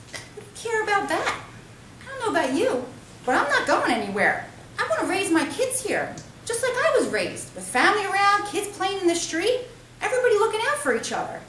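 high, heated plea; earnest city guy, fired up; impassioned brother. Acted. Brother defending neighborhood.

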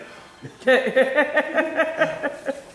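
laugh. Acted. Machine laughs between messages.

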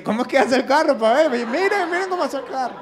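hammed-up mockery, showing him off to the workshop; mocking mechanic. A mechanic mocking the customer to his coworkers, loud and teasing.

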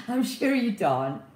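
high, slow comic impression; Keith Lemon. Repeating the joke through giggles, comedian impression. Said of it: "I sound like Keith Lemon".

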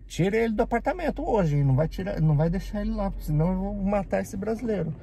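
high, heated order with a threat; retold angry threat. Speaker retelling ordering the boss to remove the coworker, high and heated. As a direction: get him out of here or else. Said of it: "falar pro Tantocha".

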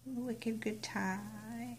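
breathy, drawn-out whisper of the stamp phrase; slow whisper. Pretend. Crafter whispering her stamp's words to herself, slowly. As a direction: whisper Wicked good time slowly, almost to yourself.

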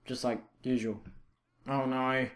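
ordinary delivery

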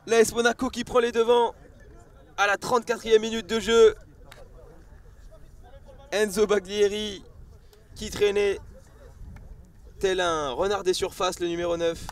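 shouting the goal, high and loud; excited sports commentary. Real, not acted. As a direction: roar it out as the goal goes in.

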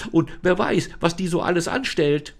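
put-on high girl's voice, fretting; girl Lisa, worried. Lisa frets about the false Lisa, high and worried. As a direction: sound high and anxious.

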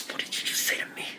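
whispered challenge; hushed confrontation. Acted. Confronting someone over what they just said, whispered. Sketch confrontation, threatening question.

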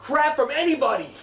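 shouted out. Acted. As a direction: yell it. Shouting the slogan.

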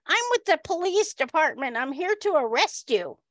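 imitated big cop with high voice; high-pitched mock official announcement; high-voiced policeman. Cop arrests a wig-room worker, high mimicked voice. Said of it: "he says".

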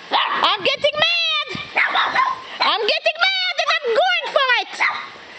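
high, playful mock-mad dog's voice; voicing the dog. Owner speaks for her dog going for it, playfully mock-mad.

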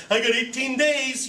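a touch of a smug addict bragging to mom; mock proud. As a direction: brag like a kid wanting praise.

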